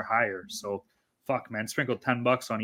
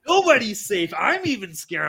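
hyped-up, higher-pitched mock alarm; podenerwowany hype na żarty; 8 semitones higher, 5 dB louder than usual. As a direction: raise the pitch and ham up the warning. Hyped-up Discord shout-out, mock alarm.

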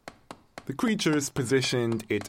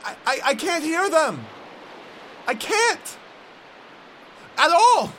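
high, loud stammering distress; high loud shaken stammer; 5 dB louder than usual. Acted. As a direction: cry out in growing alarm. Can't hear the creatures.

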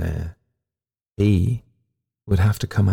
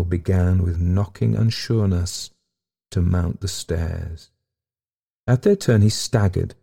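ordinary delivery, nothing marked

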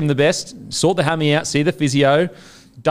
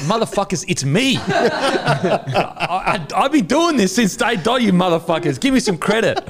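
higher, laughing mock rant; mock outrage; 7 semitones higher than usual. Host complaining fans credit Matty, laughing mock outrage. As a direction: play-act being robbed of credit. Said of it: "I'm like".

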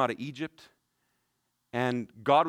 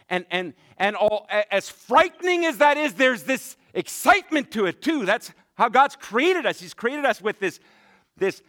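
excited; 6 semitones higher, 4 dB louder than usual. Real, not acted. Preacher builds to excitement.

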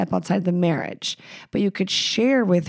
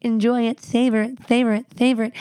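mocking advice; 4 semitones higher, faster than usual. Mocking people who say savor it.

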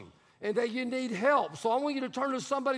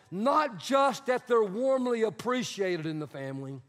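usual speaking voice

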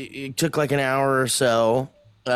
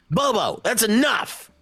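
raised, stern yell at a pet; scolding shout; 9 semitones higher than usual. Pretend. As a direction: shout a firm no. Scolding the little parasite.

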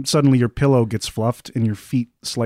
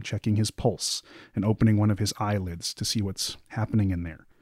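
ordinary talk, nothing marked

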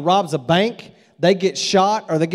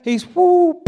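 mock whoo; 10 semitones higher, 3 dB louder than usual. Son thrown off steer.

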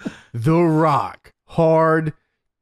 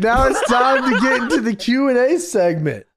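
announcer shout; 7 semitones higher than usual. Real, not acted. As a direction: shout it like a show host. Announcing the segment.

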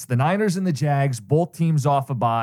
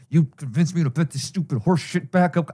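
mocking his text. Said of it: "I don't think I speak that way".

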